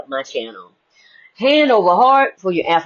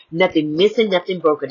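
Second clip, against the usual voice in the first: ordinary delivery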